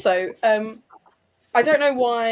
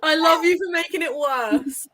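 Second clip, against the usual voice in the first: words squeezed out between laughs, pitch higher; laughing through it; 7 semitones higher than usual. Real, not acted. To the friend who made it worse, laughing helplessly. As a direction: still cracking up, giving in to the joke.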